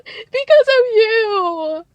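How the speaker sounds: very high, loud, dragged-out whine; mocking Kristen. Host mimicking Kristen blaming Cece, high drawn-out whine. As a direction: stretch it into a shrill mock accusation. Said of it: "Kristen says".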